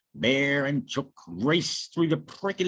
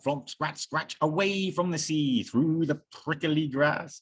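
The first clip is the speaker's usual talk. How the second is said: usual speaking voice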